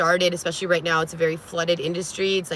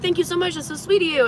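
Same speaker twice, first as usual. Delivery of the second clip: overly sweet reply; 9 semitones higher than usual. Retold or imitated. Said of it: "I was like".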